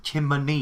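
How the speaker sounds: "Chimney" spelt out with a clear N before the final ee; chimney said correctly. Shown as a demonstration. Friend correcting Ryan on the word, clearly pronounced "Chimney". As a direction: stress the N in "Chimney". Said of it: "That's how you pronounce it".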